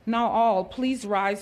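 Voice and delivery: loud call to the crowd. Real, not acted. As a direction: raise the voice to get attention. MC calls the audience to rise.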